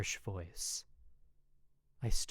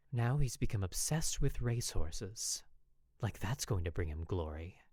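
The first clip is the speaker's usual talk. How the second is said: ordinary delivery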